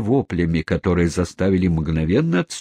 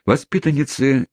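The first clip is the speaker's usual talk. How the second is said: usual speaking voice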